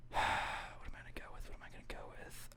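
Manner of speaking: unvoiced muttered thinking aloud; whispering. Real, not acted. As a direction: whisper to yourself, pondering. Pondering pick to himself.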